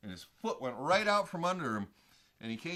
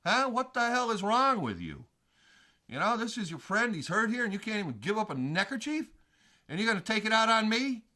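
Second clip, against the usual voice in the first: higher, indignant outburst at the bikers; angry rant; 6 semitones higher than usual. Retold or imitated. Yelling at the bikers who won't help their hurt friend, angry. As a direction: tell them off, voice up and pushing. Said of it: "I'm like".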